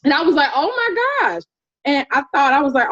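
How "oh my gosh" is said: high-pitched retold shock; mock-amazed gasp of realization; astonished reaction. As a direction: react as if floored by it. Her shocked reaction to great-grandmother.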